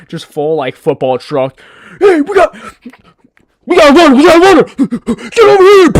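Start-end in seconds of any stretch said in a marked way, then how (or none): 2.0-5.9 yelling loud and high like a cop on a chase. Pretend. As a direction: bark out the alert to the other officers. Acting out the cop chasing a runner.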